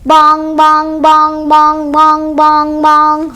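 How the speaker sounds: loud ringing bong, bong; loud bell bongs. A narrator's reading. Storyteller booming bell sound to the children. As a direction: call out the bell like a big church bell.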